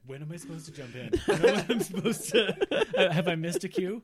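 real laughter pushing the voice high; laughing. Real, not acted. Performer asking about a missed cue, laughing hard.

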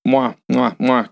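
quick imitated smooches; mock kissing. Pearl kissing the projectionist to hush him, mock kissing. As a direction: make repeated exaggerated kiss noises. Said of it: "kissing him and shit".

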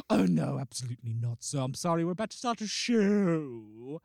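loud theatrical refusal; affected staff voice. Retold or imitated. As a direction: dismiss them like a grand stage host. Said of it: "they speak like this".

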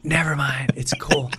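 hushed, awkward retreat; soft retold brush-off; embarrassed backing out. Backing out awkwardly near Billy.

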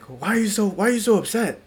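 high, loud puzzled dad; imitating dad. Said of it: "And he was like".

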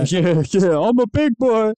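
mock childish. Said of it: "mocking friend's words".

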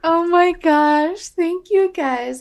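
high, slow delighted thanks; touched. Real, not acted. Birthday girl thanking friends, high and slow.

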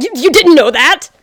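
loud hammed-up incredulous question; loud sneering mock surprise; mocking disbelief. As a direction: parody the friend's shock.

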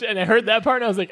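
usual speaking voice

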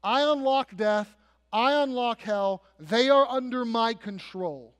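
forceful preaching as the risen Christ; commanding declaration. Acted. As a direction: proclaim power in deliberate, punched phrases. Preacher voices Christ declaring authority.

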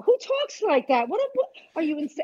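indignant who-says-that reaction; retold indignation. Retold indignant reply to mother-in-law.

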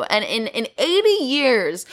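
ordinary delivery

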